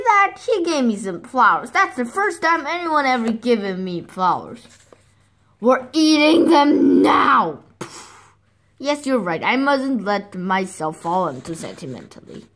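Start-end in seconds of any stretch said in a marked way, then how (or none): 5.6-7.6 loud, slow, high angry shout. Acted. Hungry wolf losing patience with mother, loud angry shout. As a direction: demand it now, furious.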